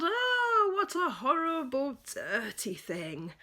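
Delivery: loud, high disgusted yell. Acted. Parents yelling about the filthy toy, disgusted. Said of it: "They yelled".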